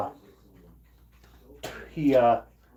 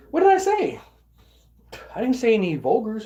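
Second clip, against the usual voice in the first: high, louder retold protest; indignant protest; 7 semitones higher, 4 dB louder than usual. Worker protesting to bosses after being fired, indignant. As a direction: act out shocked objection, pitched way up.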